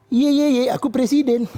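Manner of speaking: playful mock boast; mock gloating. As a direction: rub it in like a kid teasing. Jokowi gloating again.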